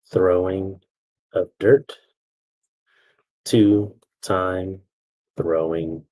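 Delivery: slow, broken dictation with long pauses; dictating. Real, not acted. Teacher giving bullet points to write down, dictating slowly. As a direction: spell out the points phrase by phrase.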